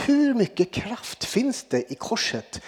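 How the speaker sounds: slow breathless whisper of doubt. Shown as a demonstration. Preacher voicing the congregation's secret doubt, whispered. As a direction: hush it like a private thought.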